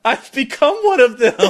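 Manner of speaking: cracking up in disbelief at yourself; laughing disbelief. Retold or imitated. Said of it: "I was like".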